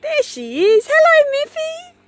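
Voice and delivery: very high, loud sing-song dog greeting; doggy voice. Real, not acted. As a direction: greet the dog fondly from afar.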